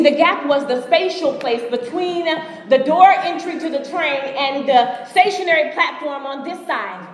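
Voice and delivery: ordinary delivery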